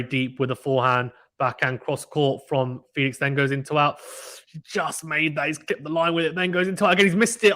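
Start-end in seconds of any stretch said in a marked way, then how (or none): 6.4-7.5 excited call. Real, not acted. Commentator calling a wide forehand, rising excitement. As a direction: voice jumps up high and loud on the miss. Live call of a missed shot.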